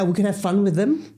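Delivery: normal talking voice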